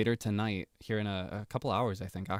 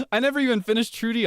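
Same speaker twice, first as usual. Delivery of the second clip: excited; 12 semitones higher, 6 dB louder, faster than usual. Real, not acted. Excited squeal about game character.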